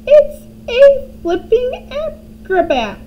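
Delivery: high, loud, slow crone cry; grandma shouting at the hen; Grandma cackling shout. A narrator's reading. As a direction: shriek it with wild delight.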